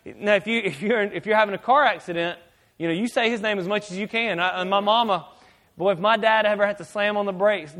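ordinary delivery

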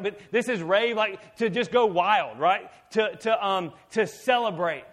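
high-energy, loud sermon delivery; full-force preaching. Real, not acted. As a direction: preach it out to the whole room.

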